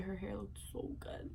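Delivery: breathy whispered aside about her friend's hair; whispered gush. Real, not acted. To viewers, whispering how good the hair looked. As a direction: whisper it like a secret compliment.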